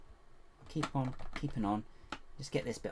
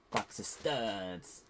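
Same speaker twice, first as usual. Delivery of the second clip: odd slow voice; much slower than usual. Pretend. Builder repeats box of studs slowly in a silly put-on voice. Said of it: "I don't know why I said that like that".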